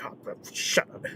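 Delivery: voiceless, soft imitation of sulking dad; annoyed grumble. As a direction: grumble it under your breath, fed up. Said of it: "he's just silent".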